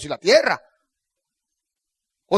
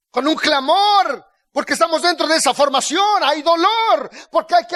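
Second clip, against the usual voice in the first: raised, emphatic sermon voice; full-force preaching; 6 semitones higher, 3 dB louder than usual. Real, not acted. Preacher teaching believers to depend on God, insistent preaching. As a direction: drive each phrase up and land it hard.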